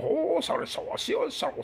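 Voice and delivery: high, breathy fake Chinese gibberish fading to a whisper; mock Chinese. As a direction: parrot babbling pretend Chinese, high then hushed. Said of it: "¿Ves? Habla chino". (chino de burla, agudo y luego susurrado)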